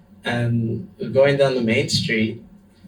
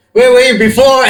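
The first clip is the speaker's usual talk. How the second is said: much higher and louder mock outrage; sarcastic retort; 12 semitones higher, 13 dB louder than usual. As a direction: throw it back at them, laughing indignation. Sarcastic retort after the danger.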